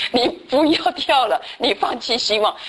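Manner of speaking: slow, laughing as if scolding; laughing mock telling-off. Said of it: "其实上面的人就说". (边笑边慢慢说不要跳)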